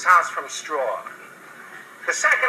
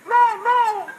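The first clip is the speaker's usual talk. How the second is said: squeaky pig; 4 dB louder than usual. Acted. Pig refuses the wolf.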